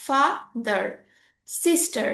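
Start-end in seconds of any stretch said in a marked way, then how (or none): 0.1-0.8 father as two beats, ফা then দার; split into two syllables. Shown as a demonstration. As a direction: pause between ফা and দার in father. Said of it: "আমরা দুই ভাগ করে পড়লাম".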